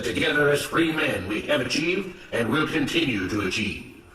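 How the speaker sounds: firm, declamatory public speech; rally the workers with righteous conviction; proclaiming overseer. Acted. Mister Smith rallying workers around the plantation's rules, proclaiming.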